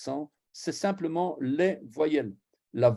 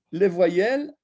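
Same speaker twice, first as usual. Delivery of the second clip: en riant; 6 semitones higher, 4 dB louder than usual. Real, not acted. Rit de l'anecdote.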